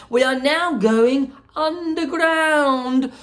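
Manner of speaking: high, lively showman voice; bubbly chocolatier welcoming guests; Willy Wonka voice. Acted.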